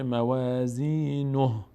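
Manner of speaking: موازینه slow, ending on a vowelless breathed ه; silent final ha. Shown as a demonstration. Teacher showing the end of the verse, slow chant stopping on ه. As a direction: stretch موازینه and stop on the ه.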